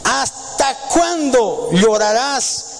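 high, slow, intoned Bible reading; solemn scripture reading. Acted. Preacher reading God's words to the congregation, slow and solemn. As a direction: lift each phrase slowly like holy words.

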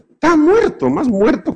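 "Está muerto" shot up high in mock outrage; outraged exclamation. Real, not acted. Mock outrage at the yellow celery.

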